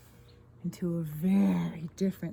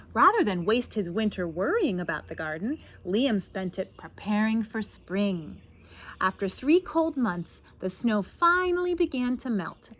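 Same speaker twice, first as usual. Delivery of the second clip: lively, projected read-aloud with lifted key words; picture-book narration; 6 dB louder than usual. A narrator's reading. Reader tells children of a snowed-in gardener, animated storytelling. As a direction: read it out brightly for young listeners.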